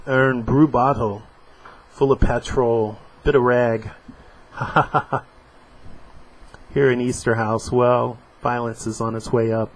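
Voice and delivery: comic strip voiced lightly; dramatic reading. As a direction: act out the panels. Reading comic dialogue aloud.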